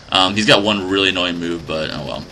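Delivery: ordinary talk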